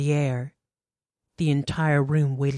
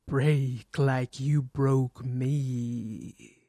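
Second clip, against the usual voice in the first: very slow, low, soft shadow voice; the shadow taunting Natalie, slow, low and hollow; slow ghostly menace; 4 semitones lower, 4 dB quieter, much slower than usual. A narrator's reading. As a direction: drag each word out like a vengeful ghost. The shadow speaks.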